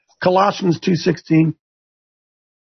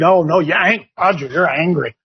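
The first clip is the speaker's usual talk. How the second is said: lower, mock-reproachful "you're angry, Roger"; mock scolding church folk; 6 semitones lower than usual. Host mimicking churchgoers chiding Roger, lightly mock-reproachful. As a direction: mimic a finger-wagging churchgoer. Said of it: "we've taken that and said".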